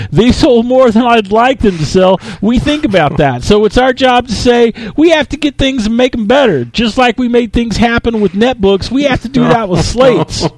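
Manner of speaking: high, hammed-up shouting CEO; Steve Ballmer. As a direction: bellow every phrase like an over-excited executive rallying analysts. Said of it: "absolutely fantastic Steve Ballmer impression".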